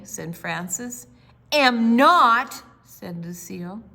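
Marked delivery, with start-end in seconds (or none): 1.5-2.6 put-on loud stubborn child's retort; childish retort. A narrator's reading. Lucille denying to her sister she is too young. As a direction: shoot it back like a pouting little girl. Lucille protests in read story.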